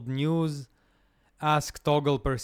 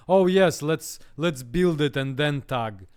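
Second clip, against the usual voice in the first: mocking quote; 3 semitones higher, 7 dB louder than usual. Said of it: "why the previous session told me".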